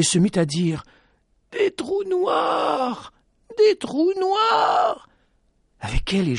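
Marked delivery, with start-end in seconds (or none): 1.5-5.1 very high, very slow, crying shout; crazed terrified cry. Acted. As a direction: wail it as if losing her mind. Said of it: "comme si elle avait perdu la raison". (cri halluciné, très aigu et très lent, en pleurs)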